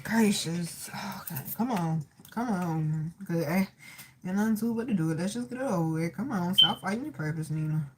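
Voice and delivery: low, hushed talking to herself; quiet self-coaxing. Real, not acted. Medium telling herself to stop fighting her purpose, quietly. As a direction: gather yourself under your breath, very softly.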